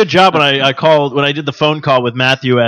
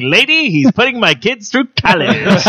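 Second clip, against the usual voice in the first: vendor laughing; 9 semitones higher than usual. Retold or imitated. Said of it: "He's like".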